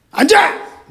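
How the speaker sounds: sharp high shouted order; retold shout. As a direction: bark the command like an angry pastor. Pastor barges in, orders sit. (화난 목사처럼 높게 외치는 "앉아!")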